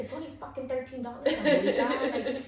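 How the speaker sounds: high, loud, fast laughing rant. Real, not acted. Laughing while scolding herself over a toy in the store. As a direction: laugh through a mock self-scolding.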